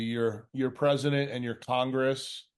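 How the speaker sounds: ordinary talk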